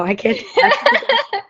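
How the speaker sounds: high squeaky laughing no; laughing refusal. Real, not acted. Asked to do an Irish accent, she squeals a laughing refusal. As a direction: squeal it out, embarrassed and amused.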